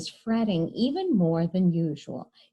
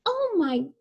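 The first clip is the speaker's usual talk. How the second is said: pitched way up in shock; high mock alarm; 12 semitones higher than usual. Mother sees her striped daughter, high startled cry. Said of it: "she cried".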